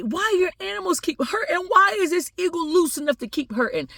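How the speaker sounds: high, indignant protest; livid family. Acted. Family demanding answers about the eagle, livid and high. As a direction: protest in high-pitched mock outrage. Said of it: "They were livid".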